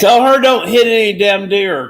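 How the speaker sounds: loud and high, make sure she hears; shouted warning. Real, not acted. Shouting a warning toward the phone.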